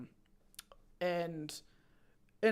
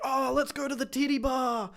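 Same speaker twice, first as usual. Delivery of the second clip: higher, louder rowdy mates suggesting the bar; mock drunk enthusiasm, rising to the blah blah; drunk mates hyped; 6 semitones higher, 5 dB louder than usual. Drunk friends pushing a trip to the titty bar, rowdy. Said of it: "they're like".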